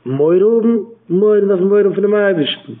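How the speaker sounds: high, loud sermon cry; fervent preaching. Acted. Preacher urging his listeners, fervent and loud. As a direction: lift the voice to heaven.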